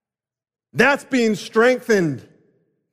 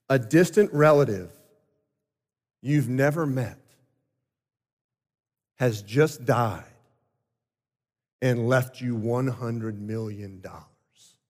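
calm father; 7 semitones lower, 4 dB quieter than usual. Retold or imitated. Father tells his crying son of an inheritance, soft and low. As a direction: gently break big news to a child. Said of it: "the father says to his eight-year-old son".

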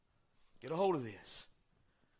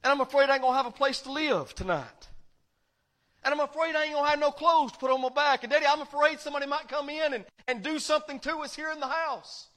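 put-on fast, higher pleading child voice; frightened child; 7 semitones higher, 7 dB louder, faster than usual. Children telling Daddy their fears, fast scared pleading. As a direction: rattle off the fears like a scared kid. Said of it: "they looked up at you and they said".

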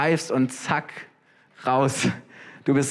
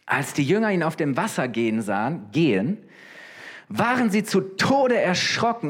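ordinary delivery

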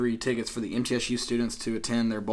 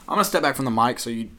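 normal talking voice

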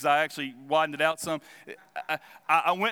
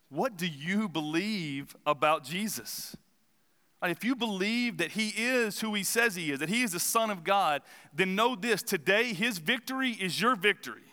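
earnest preaching, a little higher; preaching appeal; 4 semitones higher than usual. Acted. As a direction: call the listeners to believe. Closing appeal.

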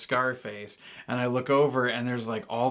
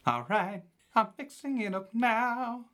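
very high, slow, crooning falsetto; 9 semitones higher, slower than usual. Acted. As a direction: croon every word like a soul singer. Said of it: "my name is [name]".

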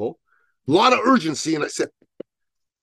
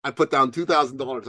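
ordinary talk

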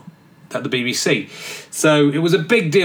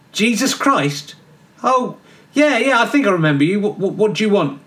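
cheeky geezer John; 5 semitones higher than usual. Acted. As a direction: play John as a careless lad in a cell. John the Baptist as bloke.

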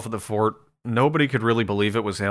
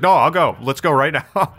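eager; 5 semitones higher, 5 dB louder than usual. Retold or imitated. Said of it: "And he's like".

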